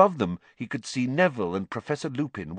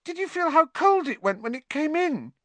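much higher and louder, shaken; 4 dB louder than usual. A narrator's reading. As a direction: push it up high, frightened boy. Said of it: "in a higher voice than usual".

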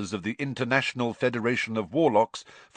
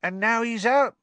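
a high, loud, chatty voice; cheeky Cockney conductor; 6 dB louder than usual. A narrator's reading. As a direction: gossip like a bus conductor. Stan to Harry.

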